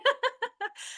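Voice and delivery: a quick high giggle; little burst of excited laughter. Real, not acted.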